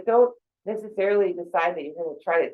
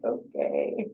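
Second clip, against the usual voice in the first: much lower, dubious "okay"; imitating a passerby; 6 dB quieter than usual. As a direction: drop the voice low as a puzzled stranger. Friend answering her oddly.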